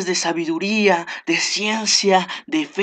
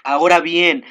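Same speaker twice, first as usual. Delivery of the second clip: normal talking voice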